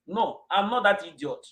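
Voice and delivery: usual speaking voice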